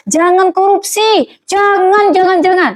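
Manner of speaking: high, forceful falling commands; emphatic warning. Acted. Preacher acting someone firmly denouncing corruption, emphatic warning. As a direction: hammer out each JANGAN high.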